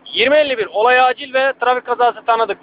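ordinary delivery

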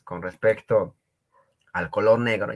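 ordinary talk

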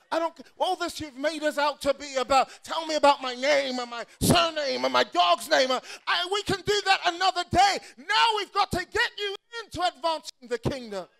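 loud, driving preacher's cadence; full-force preaching. Acted. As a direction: hammer it home to the congregation.

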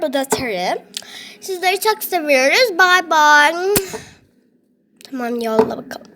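ordinary talk

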